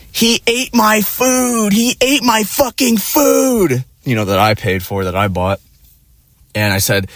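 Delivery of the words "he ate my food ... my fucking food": slow, high-pitched hysterical mimicry; mock tantrum. Imitating girlfriend enraged over eaten food, mock tantrum. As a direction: imitate her high, drawn-out wail.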